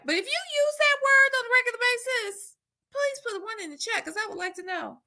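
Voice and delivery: mock high request. Real, not acted.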